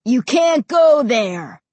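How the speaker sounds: loud, slow, drawn-out warning; forbidding call. A narrator's reading. Ray to Josh ahead, forbidding call. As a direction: forbid it across a distance.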